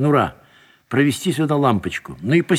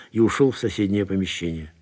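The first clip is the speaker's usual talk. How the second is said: ordinary delivery, nothing marked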